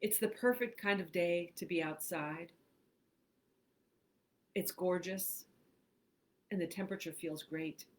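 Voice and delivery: measured, spacious reading with long pauses; guided imagery meditation. Acted. Host leads listeners into imagined woods, guided meditation.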